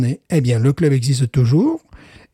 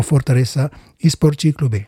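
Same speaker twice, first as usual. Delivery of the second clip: Brazilian Portuguese pronunciation of the club name; Portuguese accent. Shown as a demonstration. Co-host congratulates the Brazilian club, Portuguese accent. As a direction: say it with a practised Lusitanian accent. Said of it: "je progresse, je progresse".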